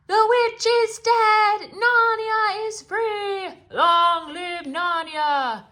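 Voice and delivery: very high, loud, slow cheering; victory cries. Acted. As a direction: cheer in triumph as the crowd. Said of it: "the cries went up".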